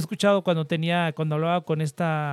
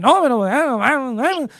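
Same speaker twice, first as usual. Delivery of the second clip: loud, higher mock northern Mexican accent; 5 semitones higher, 6 dB louder than usual. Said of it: "tienen un acento así como de".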